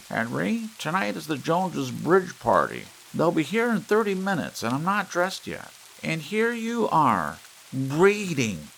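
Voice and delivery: high, loud scolding wife voice; wife scolding husband for reading, high and loud; nagging wife. A narrator's reading. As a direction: scold him like a bossy wife. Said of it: "She had emphasized the last word".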